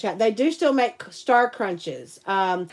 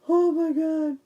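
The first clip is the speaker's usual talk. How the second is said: ordinary talk, nothing marked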